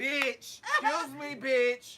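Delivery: high, loud put-on customer voice yelling for the waiter; hammed-up diner calling the waiter rudely; mock rude customer yell. Imitating rude restaurant customer.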